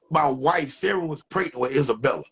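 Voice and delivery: ordinary talk, nothing marked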